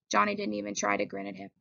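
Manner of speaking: ordinary delivery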